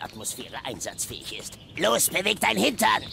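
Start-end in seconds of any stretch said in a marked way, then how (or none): 1.8-3.0 loud impatient command; lauter Befehl. Acted. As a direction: push him to hurry. Befehl an Crypto. (ungeduldiger Befehl)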